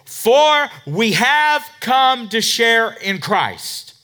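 loud, slow verse read; preaching the verse. Acted. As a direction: proclaim it slowly. Reads verse at full force.

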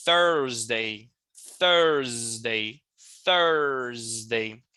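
"Thursday" low, "Thurs" held before the D; TH, then D. Shown as a demonstration. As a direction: model "Thursday" in two parts, THURZ-day. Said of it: "E aí vem esse D".

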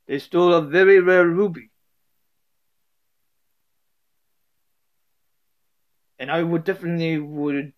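lightly put-on Russian voice; amateur attempt at a Russian character; a loose, homemade Russian accent. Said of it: "a Russian voice".

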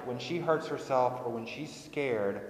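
normal talking voice

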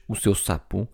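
plain reading voice

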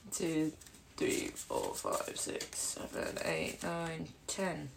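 very soft whispered count; counting under breath. Real, not acted. Player checking a hand of cards, murmuring the count. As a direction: count to yourself, barely voiced.

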